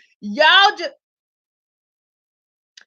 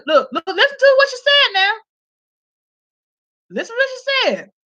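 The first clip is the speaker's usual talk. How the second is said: excited urging; 9 semitones higher than usual. Real, not acted. Urging viewers through laughter.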